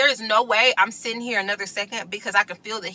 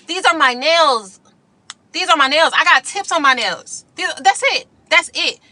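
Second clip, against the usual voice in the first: heated insistence; 6 semitones higher than usual. Retold or imitated. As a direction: put your foot down. Defending her nails heatedly.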